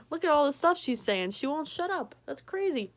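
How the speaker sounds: ordinary delivery, nothing marked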